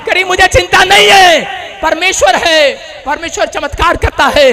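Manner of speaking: high, ringing revival declamation; impassioned preaching. Acted. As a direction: proclaim the mother's faith with full conviction. Preaches the miracle in raised voice. (पूरे जोश से ऊँची आवाज़ में प्रचार)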